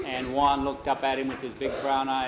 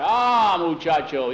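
hearty greeting; 4 semitones higher, 6 dB louder, slower than usual. Retold or imitated. Jose greets the boy.

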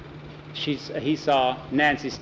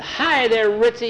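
excited greeting; 8 semitones higher, 3 dB louder than usual. Acted. Greeting the dog happily.

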